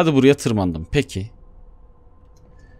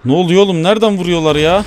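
alarmed yelling; 5 semitones higher, 5 dB louder than usual. Real, not acted. Under fire in game.

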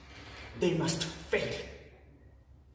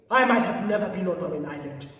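ordinary delivery

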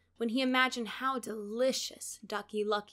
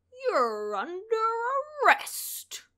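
slow, firm officer; arrest announcement; 11 semitones higher, 5 dB louder, slower than usual. A narrator's reading. Sergeant arrests the fox, slow and firm. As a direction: announce it firmly. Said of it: "he announced".

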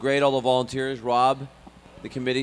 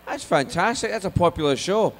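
excited, higher Scottish father's voice; thick Scottish accent; 8 semitones higher, 4 dB louder than usual. Retold or imitated. Dad delighted by X-Files part, excited Scottish. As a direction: gush like an impressed Scottish dad. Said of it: "my parents have a very thick Scottish accent".

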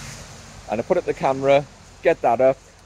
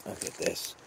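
whispered and much softer; hushed whisper; 8 semitones lower, 18 dB quieter than usual. Real, not acted. Man finding cut trees on his land, whispering in dismay. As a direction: breathe it out, unvoiced.

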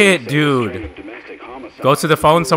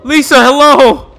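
high, loud shouts into the dark; frightened calling out; 11 semitones higher, 8 dB louder than usual. Real, not acted. Streamer calling for Lisa in the dark, loud frightened shouts. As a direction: call her name in alarm.

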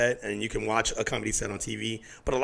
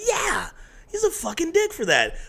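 much higher, slow mock outrage; agreeing indignation; slower than usual. Rapper retelling his reply to Louis C K. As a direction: pile on the friend, half laughing. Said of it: "And I was like".

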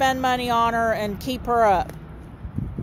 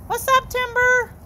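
pet talk; 9 semitones higher than usual. Real, not acted. Owner greeting the cat Timber in high pet talk.

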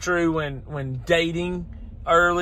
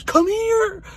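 imitating a scared little boy, much higher; son's panicked call; 14 semitones higher than usual. Retelling his son calling him to the backyard, mock child voice. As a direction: pitch way up like a crying kid. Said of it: "He goes".

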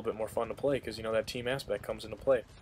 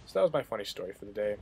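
ordinary talk, nothing marked

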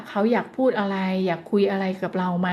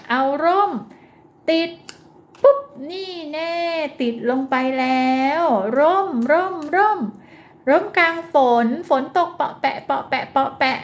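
put-on high, sing-song child-directed play voice; playful play narration; 5 semitones higher than usual. Shown as a demonstration. Therapist plays the umbrella sticker game for a child, playful and high. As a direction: make it fun like playing with a toddler. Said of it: "อย่าลืมภาษาท่าทางนะคะ".

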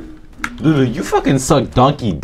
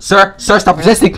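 cop yelling; 9 semitones higher, 5 dB louder than usual. Pretend.

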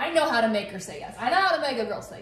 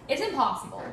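usual speaking voice

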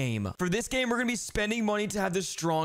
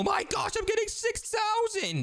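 excited; slower than usual. Real, not acted. Huge strength gain, squeals.

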